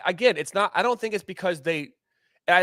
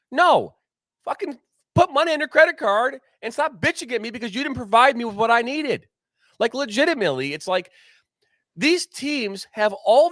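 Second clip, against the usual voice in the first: angry outburst; 7 semitones higher than usual. Real, not acted. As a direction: snap back at the people blaming him. Venting at blaming teams.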